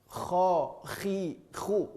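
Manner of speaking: soft, separated KHO, KHI, KHU; KHO, KHI, KHU quietly rasped at the upper throat; throat-tip kh. Shown as a demonstration. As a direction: let the scrape be felt in KHO, KHI, KHU. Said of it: "bisa dirasakan di sini".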